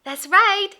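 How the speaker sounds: ordinary delivery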